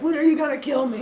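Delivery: put-on high whimpering kid voice; high-pitched, comically fearful plea; mock terrified child. Boy clinging to stair bars asking his captor, high fearful whine.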